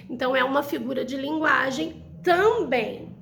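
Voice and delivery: usual speaking voice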